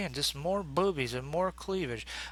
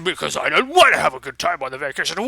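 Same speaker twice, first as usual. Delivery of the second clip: loud, fast, sulky comeback; mock grumpy retort; 8 dB louder, faster than usual. Worf grumbling back at Dax in the argument, hammed-up. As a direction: snap back like a stubborn sulker.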